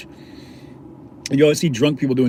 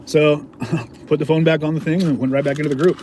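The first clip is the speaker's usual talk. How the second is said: plain reading voice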